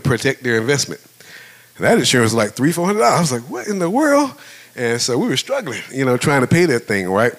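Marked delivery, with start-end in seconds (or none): 3.5-4.3 high-pitched retold astonishment; mock disbelief. Husband reacting to the insurance bill, mock disbelief. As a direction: act out baffled shock at a bill.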